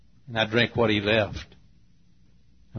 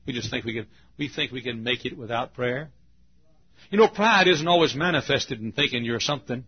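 normal talking voice

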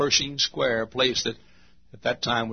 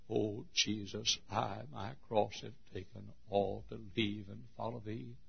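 tender prayer; 14 dB quieter than usual. Acted. Quoting a hymn prayer.

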